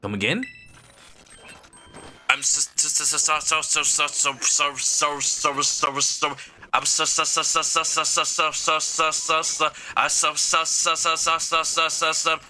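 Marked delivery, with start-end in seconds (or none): 2.3-12.4 stuck in a stuttering loop; broken AI repeating itself; glitching robot voice. Acted. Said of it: "you're creeping us out".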